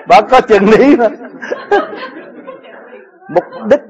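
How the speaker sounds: real amused laughter while speaking; laughing. Real, not acted. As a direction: keep laughing as you say it. Laughing at own joke.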